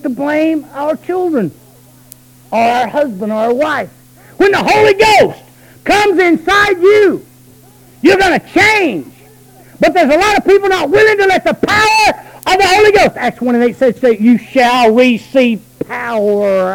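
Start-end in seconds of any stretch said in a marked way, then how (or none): 4.4-13.2 full-force preaching. Acted. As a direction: preach it out with fire.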